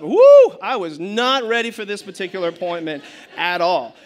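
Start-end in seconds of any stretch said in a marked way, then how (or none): 0.0-0.6 very high, loud joyful whoop; excited whoop. Real, not acted. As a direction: let out a big cheer. Whoops about loving appointments.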